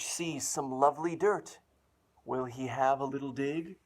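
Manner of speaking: put-on loud, bouncy storybook reading for kids; animated children's book narration; excited read-aloud. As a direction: sell every page to little listeners. Reads picture book aloud excitedly.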